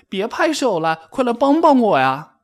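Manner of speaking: high, loud frantic yell; high-pitched shouted plea; crying out for help. A narrator's reading. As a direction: call out urgently for a friend's help. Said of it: "蹦蹦大叫".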